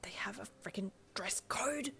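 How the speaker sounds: unvoiced, urgent whisper; shocked whisper to her boyfriend; hissed whisper. A narrator's reading. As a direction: hiss it so nobody hears. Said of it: "you hissed at Darby".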